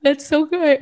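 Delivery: words stretched by a yawn. Real, not acted. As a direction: say it mid-yawn. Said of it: "Oh, sorry, I was yawning".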